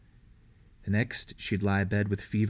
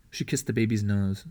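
ordinary delivery, nothing marked

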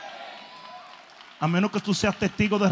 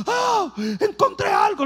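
much higher, louder startled cry of a doctor; alarmed doctor; 12 semitones higher, 5 dB louder, slower than usual. Retold or imitated. Doctor startled by the test results, high alarmed cry. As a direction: play the doctor jumping at what he found.

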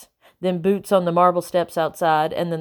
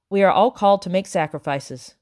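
plain reading voice

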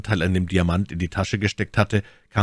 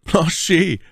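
high, elated call of the name; joyful, pitched-up exclamation; overjoyed cry; 12 semitones higher, 4 dB louder than usual. A narrator's reading. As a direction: cry out with sudden joy. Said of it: "rief D'Artagnan vor Freude berauscht".

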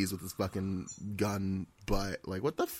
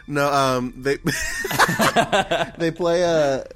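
ordinary talk

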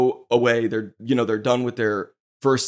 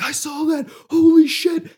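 much higher, shrieking with disbelief; friend's wild excitement; 5 dB louder than usual. Retold or imitated. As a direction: squeal it like you can't believe your eyes. Friend reacting wildly in crowd.

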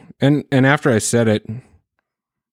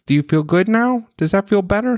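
put-on high-pitched praise for a little kid; parent's singsong coaxing, pitched well up; parent praising child; 8 semitones higher than usual. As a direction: imitate encouraging a small daughter. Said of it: "and I'm like".

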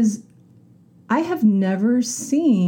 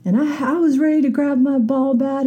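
higher, hammed-up road-rage friend; mock-furious retelling; angry friend; 8 semitones higher than usual. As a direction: lightly imitate a fuming friend. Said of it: "one of my friends just last week was like".

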